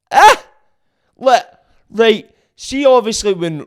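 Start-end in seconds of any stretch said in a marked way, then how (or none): 0.1-0.4 sudden high, loud yelp of disbelief; incredulous yelp. Real, not acted. Mocking the royal family, a sudden high loud yelp. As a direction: blurt out a shocked high cry. Outburst after Kardashians jibe.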